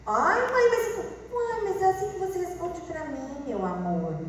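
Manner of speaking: put-on high, whiny kid; plead, hurt, with mom; protesting child. (filho reclamando com voz aguda e chorosa)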